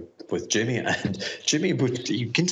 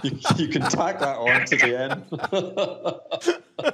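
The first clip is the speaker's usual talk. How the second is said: slow, higher, laughing aside; laughing afterthought to the host; 7 semitones higher, slower than usual. Real, not acted. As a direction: chuckle through it, still amused. Laughing after his own joke.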